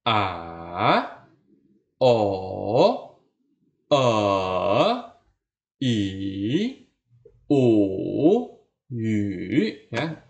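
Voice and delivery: dipping vowel drill; third tone vowels. Shown as a demonstration. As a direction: drill vowels with a dip and rise. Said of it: "turun rendah dan naik".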